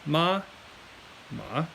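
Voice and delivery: level "ma" followed by rising "ma"; first, then second tone. Shown as a demonstration. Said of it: "So once again".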